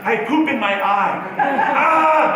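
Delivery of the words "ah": high, loud comic scream; loud "aah" yelled in mock horror; acted scream. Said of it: "I'm screaming".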